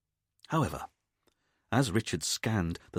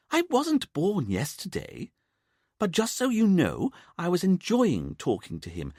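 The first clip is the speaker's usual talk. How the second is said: higher voice, firm and affronted; indignant mother; 7 semitones higher than usual. A narrator's reading. Mother telling her son she is a grown-up, indignant. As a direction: put him in his place.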